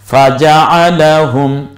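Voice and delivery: ফাজাআলাহুম with the আ drawn out slowly; correct long vowel. Shown as a demonstration. Teacher shows the correct form after the wrong one, slow and careful. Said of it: "কেউ যদি বলে ফাজালাহুম".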